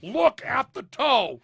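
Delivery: hammed-up stern order; insistent command. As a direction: demand he look, drawn out. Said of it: "He goes".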